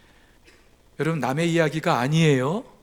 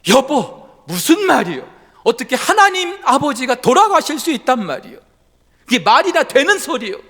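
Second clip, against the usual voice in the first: loud, higher indignant retort; angry; 9 semitones higher, 8 dB louder than usual. Acted. Luther angrily rejects his wife's words, loud and indignant. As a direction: bark it back at her, outraged. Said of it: "루터가 화가 났어요".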